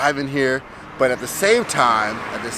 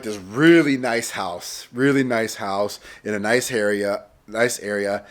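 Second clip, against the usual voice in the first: ordinary talk, nothing marked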